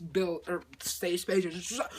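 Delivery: normal talking voice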